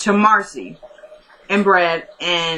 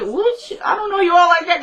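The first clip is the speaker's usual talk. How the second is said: high-pitched mock disbelief; taken aback; 10 semitones higher than usual. Patient to an overfamiliar doctor, high affronted disbelief. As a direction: act out an offended patient, voice pitched way up. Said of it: "Marcy was looking at her like".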